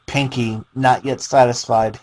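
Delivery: plain reading voice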